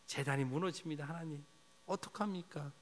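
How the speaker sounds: softly, pleading to God; a quiet lament, almost breaking; hushed plea. Pretend. Preacher voicing a prayer for the land, hushed and pleading. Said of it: "탄식하기를 원합니다".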